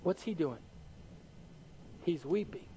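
ordinary talk, nothing marked

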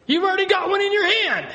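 high-pitched incredulous retort; acted outburst at an obvious absurdity; mock exasperation. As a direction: protest at the top of your range. Congregation's imagined retort to foolish plea.

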